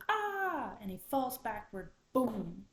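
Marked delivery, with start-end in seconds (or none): 0.1-0.8 high, loud storyteller's scream; raccoon's scream. A narrator's reading. Raccoon by the popping fire, screaming in fright. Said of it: "Raccoon screams". 2.1-2.7 loud imitated thud; falling boom. A narrator's reading. Raccoon falls backward in the story, loud boom. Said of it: "with a thud".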